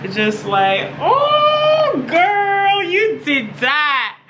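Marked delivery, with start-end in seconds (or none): 1.0-2.8 much higher, hyped-up praise; excited high squeal. Pretend. Hyping up her own look. 2.8-4.2 excited praise. Pretend. Hyping her own look.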